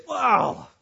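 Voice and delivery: exasperated excuse-making; angry excuse. Acted. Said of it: "But I get angry. Why? Well, you say".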